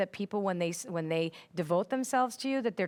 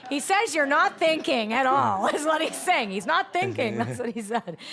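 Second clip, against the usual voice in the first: laughing; 4 semitones higher, 5 dB louder than usual. Real, not acted. Medium relaying dad's teasing, amused.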